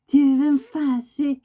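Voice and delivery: playful slow high scolding; teasing pet talk. Real, not acted. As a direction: tease the bird slowly. Talking to the pushy bird.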